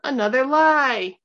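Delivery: loud, high, drawn-out outcry; indignant accusation. A narrator's reading. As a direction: throw the accusation out to the crowd. Prosecutor cuts in with accusation.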